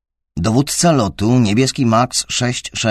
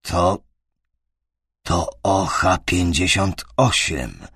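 stammered; 3 semitones lower, slower than usual. A narrator's reading. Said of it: "wydukał".

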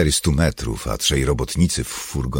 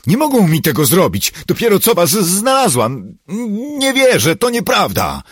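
high, furious, disbelieving outburst; loud, high-pitched raging denial; shouted; 8 dB louder than usual. A narrator's reading. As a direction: cry out in frantic protest. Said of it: "krzyknęła".

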